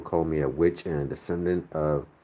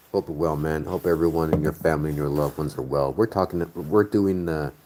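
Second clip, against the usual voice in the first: ordinary talk, nothing marked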